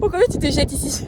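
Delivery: high, loud playful question; excited teasing. Real, not acted. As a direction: egg her on, laughing. Repeats question, laughing. (question taquine et excitée)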